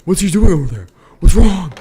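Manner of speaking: high, loud alarmed mock voice; hammed-up alarmed cry; panicked neighbours. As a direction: play startled neighbours woken at night. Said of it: "they're like".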